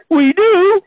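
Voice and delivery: blurted line. Retold or imitated. Blurts mangled line on set.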